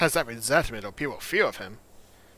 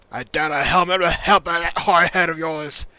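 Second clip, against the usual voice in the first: loud, raised character voice; Echo; 6 semitones higher, 11 dB louder than usual. Acted. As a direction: jab it out loud and higher.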